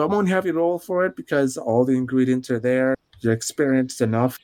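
ordinary talk

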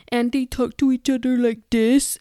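put-on cutesy couple voice; annoying couple talk. Mimicking how a TikTok couple talks, mockingly cutesy. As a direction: imitate an annoyingly sweet couple. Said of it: "and it's so annoying".

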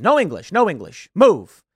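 punchy yelled commands, acted out; acted yelling. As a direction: imitate a man yelling someone away. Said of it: "he starts yelling, yelling at you".

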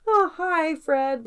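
high-pitched, mock-friendly greeting. Politician greeting a colleague who had been ill, high and friendly. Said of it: "he's really nice".

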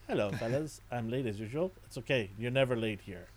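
lightly voiced King Koopa toy; soft playful villain figure voice; gruff tinny action figure. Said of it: "King Koopa says".

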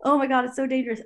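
lightly dramatized dread; retold alarm. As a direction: react as if warning bells are going off. Team alarmed at risky stunt.

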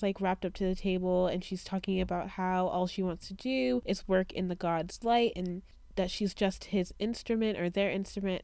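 ordinary talk

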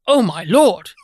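lightly hammed-up Englishman; a hint of a fussy British gent; posh British voice. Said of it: "he is the British".

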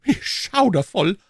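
high, slow horrified cry. A narrator's reading. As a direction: cry out in horror. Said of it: "rief die Königin".

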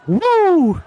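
high excited woo; excited whoop. Real, not acted. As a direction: let out a loud whoop. Hype cheer.

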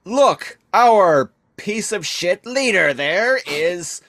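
rally the troops in a screechy villain voice; shrill Starscream rant. Retold or imitated. Starscream turning the Decepticons on Megatron, high and loud. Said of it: "He's like".

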